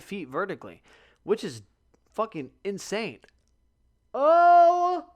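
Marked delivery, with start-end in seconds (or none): 4.1-5.1 big, high-pitched yawning groan; loud yawn. Real, not acted. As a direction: let out a huge noisy yawn. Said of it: "I told myself I wouldn't yawn".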